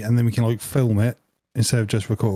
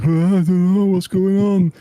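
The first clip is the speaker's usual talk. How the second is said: dazed; 8 semitones higher, 4 dB louder than usual. Retold or imitated. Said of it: "I'm like".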